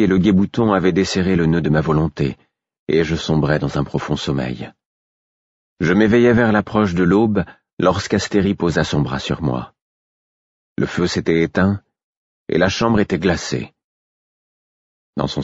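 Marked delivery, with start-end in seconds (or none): none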